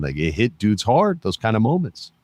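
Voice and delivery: ordinary talk, nothing marked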